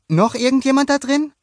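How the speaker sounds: put-on high, loud boyish question; boy asking. A narrator's reading. Luca asks Mona Lisa who else is inside, high voice. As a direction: ask the fish as young Luca.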